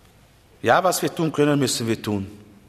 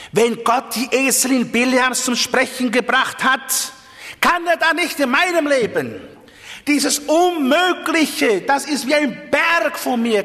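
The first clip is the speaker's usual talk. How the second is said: rising impassioned appeal; 10 semitones higher than usual. Acted. Preacher building an impassioned appeal.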